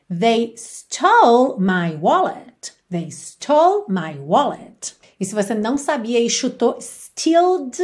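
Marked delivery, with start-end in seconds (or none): 0.1-4.9 very slow, careful model sentence; teacher modelling the answer at half speed. Shown as a demonstration. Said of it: "How would you say that in English?". 6.8-7.8 stealed said as a deliberate mistake; mock wrong past tense stealed; wrong form. Shown as a demonstration. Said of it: "não dá certo".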